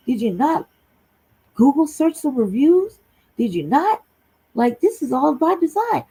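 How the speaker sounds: exasperated rant. Retold or imitated. Ranting at sisters via comments.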